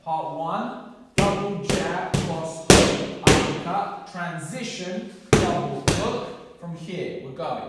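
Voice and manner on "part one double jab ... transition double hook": loud, punchy combo calls; boxing coach calling combos. Shown as a demonstration. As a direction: shout each punch on the hit. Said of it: "calling the combo during drill".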